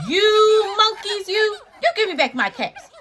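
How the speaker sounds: hammed-up cross peddler; quite angry peddler. A narrator's reading. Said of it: "Now he felt quite angry".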